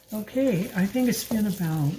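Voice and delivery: normal talking voice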